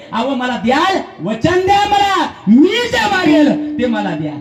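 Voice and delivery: high pleading wife's voice; coaxing wife. Acted. Wife pleading with husband for a promise, high and coaxing. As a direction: wheedle like a coaxing young wife.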